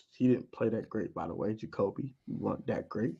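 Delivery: quiet aside. Real, not acted. Telling Jacoby he wasn't great, low and soft. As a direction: drop it low and soft like a secret.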